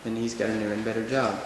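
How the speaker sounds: ordinary delivery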